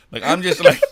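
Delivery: high, loud, laughing panic; retelling the panic with a laugh; freaking out. Real, not acted.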